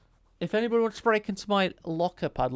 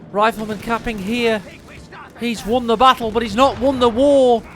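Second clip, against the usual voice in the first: excited esports casting; live play-by-play; 7 semitones higher, 10 dB louder than usual. Acted. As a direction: call the match fast and hyped.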